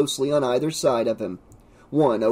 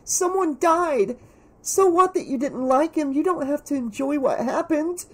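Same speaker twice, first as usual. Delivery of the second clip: put-on high, tearful girl's reproach; high-pitched distressed protest; upset demand; 13 semitones higher than usual. A narrator's reading. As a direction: scold a friend, pitched way up. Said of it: "Carrie demanded of Mario".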